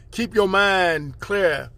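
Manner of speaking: slow, drawn-out instruction; slow mantra. Acted. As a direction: lay each word down slowly.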